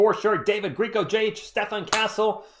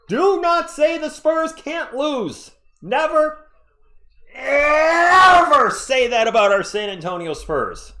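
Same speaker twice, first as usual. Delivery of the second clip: mock outrage; 9 semitones higher, 6 dB louder, slower than usual. Mock-furious fan defending team.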